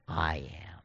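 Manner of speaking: low, soft villain whisper of triumph; evil voice. Acted. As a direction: drop it low and quiet, menacing. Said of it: "I'll try to do my, my evil voice".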